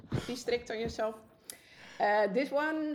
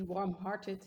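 ordinary talk, nothing marked

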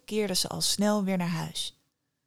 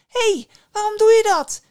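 high, loud yell of protest; shocked cry; 13 semitones higher, 10 dB louder than usual. A narrator's reading. Nera protests as the hanged man harms the family, shocked yell. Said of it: "riep Nera geschokt uit".

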